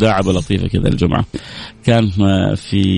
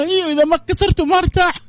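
high mock-insistent answer; insist, cheerfully stubborn; artist insists. Artist answering the anchor again, high and insistent.

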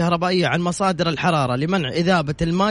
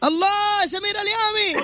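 high delighted cry; excited shout; 14 semitones higher than usual. Real, not acted. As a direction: shout the name out in joy. Host recognises friend Samir.

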